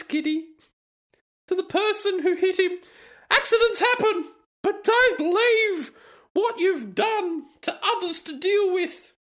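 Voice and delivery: sanctimonious falsetto parody; mocking high reading voice. Reading the post in put-on voice.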